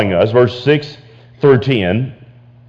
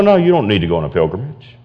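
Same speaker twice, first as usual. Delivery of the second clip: ordinary delivery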